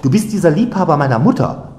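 high, loud exclamation of discovery; sudden realisation. A narrator's reading. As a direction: blurt it out as it dawns. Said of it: "rief sie plötzlich, denn sie hatte".

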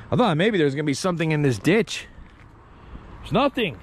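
usual speaking voice

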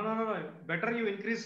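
normal talking voice

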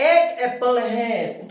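"एक" pressed firmly and slowed before Apple; stress on एक. Shown as a demonstration. Teacher shows students a stressed "एक", firm and slow. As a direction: lean on "एक" to mean just one. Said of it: "थोड़ा stress देगा".

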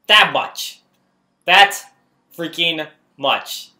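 loud, high, with freaking much slowed; outraged disbelief. Real, not acted. Gamer protesting at the screen, outraged. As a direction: spell out the outrage word by word.

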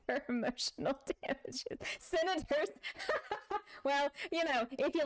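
real laughter breaking through the words; high, giggly laughing speech. Real, not acted. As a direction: talk through a fit of laughter. Laughing at a chat joke.

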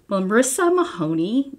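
usual speaking voice

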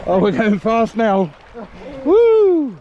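slow, loud, high whooping; excited river ride. Real, not acted. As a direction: yell with the thrill of speed. Swept along fast river.

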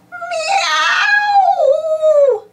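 very high, loud, long angry cat howl; an outraged yowl; cross howling cat. A narrator's reading. Pilchard howling from the rubbish heap, furious. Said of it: "she howled crossly".

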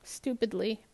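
ordinary delivery, nothing marked

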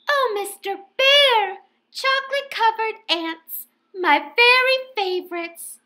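high, thrilled bear wife; high delighted bear wife; delighted Missus Bear. A narrator's reading. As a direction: gush over the gift.

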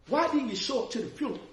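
mock indignant challenge; retold confrontation. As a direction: press him hard on why he stayed away. Confronting him about the funeral.

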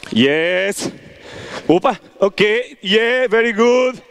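usual speaking voice

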